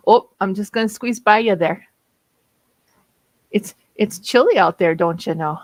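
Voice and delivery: folksy Minnesota neighbour squeezing past; hammed-up Minnesota accent. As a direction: lay the Minnesota accent on thick, chatty. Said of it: "they love my Minnesota accent".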